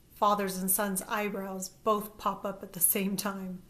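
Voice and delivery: usual speaking voice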